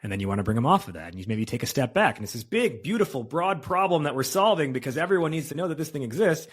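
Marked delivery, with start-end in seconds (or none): 2.4-6.5 higher, lifted pitch energy; big broad pitch. Shown as a demonstration. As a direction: open it up wide and excited.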